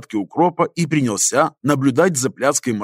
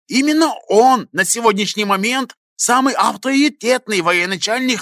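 exasperated; 7 semitones higher than usual. A narrator's reading. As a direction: urge him, heated and high. Historian passionately explains, exasperated.

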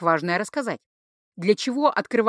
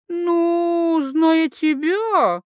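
very slow, stretched-out vowels, higher; drawled vowels; 8 semitones higher, 4 dB louder, much slower than usual. Acted. Tipsy girl teases Tatsu, drawling every vowel. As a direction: drag out every vowel teasingly. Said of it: "протянула гласные".

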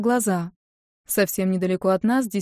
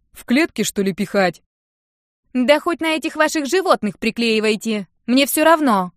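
loud, higher don't-care answer; Нинка dismissing the guard, loud and higher; dismissive; 4 semitones higher, 5 dB louder than usual. Acted. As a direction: wave him off.

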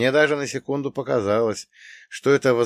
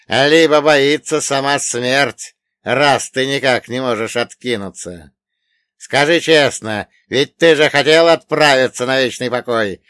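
громкий властный злодей; давит голосом, угрожая; злодей-олигарх; 3 semitones higher, 9 dB louder than usual. Acted. (громкий угрожающий олигарх)

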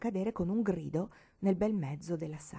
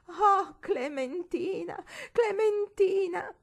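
much higher, slow, sobbing moan; loud moaning; 12 semitones higher, 6 dB louder, much slower than usual. A narrator's reading. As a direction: moan the name out, crying. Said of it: "gemeva intanto ad alta voce".

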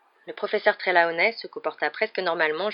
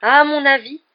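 loud, high, playful interruption; cheerful, forceful interjection; 9 semitones higher, 11 dB louder, slower than usual. A narrator's reading. Headmaster ending two professors' conversation, playful but loud. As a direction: cut in cheerfully with a strong, raised voice. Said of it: "d'une voix suffisamment forte".

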